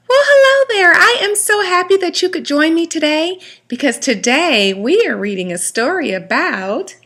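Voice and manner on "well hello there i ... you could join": high puppet voice. Acted. Puppet host greeting.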